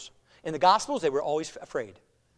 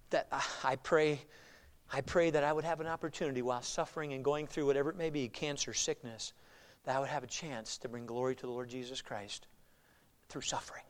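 hushed, solemn prayer; 5 dB quieter than usual. Retold or imitated. Well-known preacher telling of his prayer to suffer, hushed solemnity. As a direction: let it sink to near a murmur, with long pauses.

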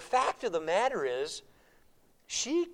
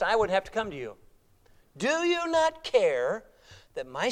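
complaining prayer; 6 semitones higher, 6 dB louder than usual. Retold or imitated. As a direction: press God with your grievance.

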